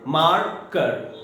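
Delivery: "मार कर" in two separate beats, मार, then कर; slow two-part demo of "मार कर"; split into syllables. Shown as a demonstration. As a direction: break "मार कर" slowly into two pieces. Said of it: "दो टुकड़ा में टूट गया".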